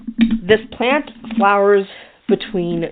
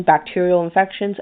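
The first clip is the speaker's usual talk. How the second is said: ordinary talk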